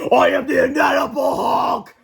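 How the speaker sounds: slow, high shouting monster; monster roar. Acted. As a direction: bellow it like a cafeteria monster. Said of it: "cafeteria food came to life".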